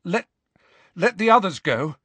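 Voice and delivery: high, urgent plea; desperate plea with a stumbling start. A narrator's reading. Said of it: "said Harry desperately".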